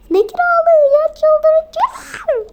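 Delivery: furious shriek about the rent; shrieking outburst. Acted. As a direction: explode at him, out of patience. Mother explodes at husband.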